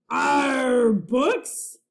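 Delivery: loud growling arr; pirate growl. A narrator's reading. Captain Bones growling at the news of books, loud disgusted pirate growl. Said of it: "growled Captain Bones".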